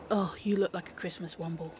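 low, whispery groan of despair; hushed, sinking disbelief; dismayed mutter. Acted. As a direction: say it under your breath, appalled. Dismayed at friend in hat.

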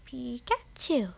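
slow, squeaky attempt at a cartoon creature, jumping up in pitch; Pikachu. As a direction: chirp it out slowly, leaping high on the later syllables. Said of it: "I can do a good Pikachu".

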